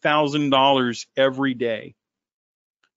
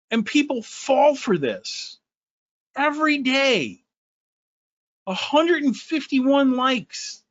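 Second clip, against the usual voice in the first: higher, slow mock-amazed voice; mocking hype; 10 semitones higher than usual. Mocking the guru's followers, slow mock-amazed voice. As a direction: scoff at the hype in a raised voice.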